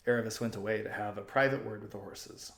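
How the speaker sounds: usual speaking voice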